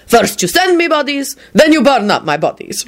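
high-pitched, resentful complaint. A narrator's reading. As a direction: complain as the aggrieved host. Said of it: "Radu told him resentfully".

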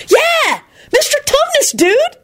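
very high, thrilled dude voice; beaming. A narrator's reading. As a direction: confirm it with goofy delight. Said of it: "Kenny beamed".

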